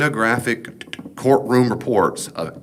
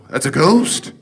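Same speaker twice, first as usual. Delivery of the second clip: imitating frightened disciples, higher and louder; hammed-up cry of fright at a figure on the water; mock scared cry; 6 semitones higher, 5 dB louder, slower than usual. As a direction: blurt it out as if spooked on the boat.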